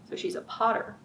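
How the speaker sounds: plain reading voice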